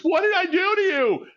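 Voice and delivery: a hint of a plaintive Christopher Robin; pleading Christopher Robin. Robin asks the bears, lightly voiced and pleading. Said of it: "Christopher Robin says".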